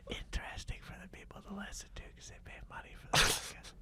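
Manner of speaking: quick whispered aside. Real, not acted. Host whispering tips to his partner before the show, fast whisper. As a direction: rattle it off under your breath, fast.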